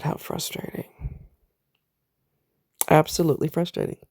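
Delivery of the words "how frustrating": breathy whisper, much softer; whispered frustration. Real, not acted. Woman at her doctor's verdict, whispered frustration. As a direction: whisper it to yourself in exasperation. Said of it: "repeated, then whispered".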